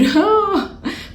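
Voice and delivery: high, falling laugh; short high-pitched laugh that drops away. Real, not acted. Vlogger reaching the food controversy, high falling laugh.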